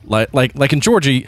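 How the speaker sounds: normal talking voice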